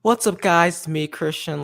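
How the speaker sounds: YouTuber intro voice. Shown as a demonstration. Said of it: "how I go, like".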